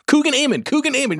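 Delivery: high-pitched mock recognition; insistent, excited name-drop; eager reminding. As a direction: jog their memory with the name, pitch up. Jogging memory of a supposed actor.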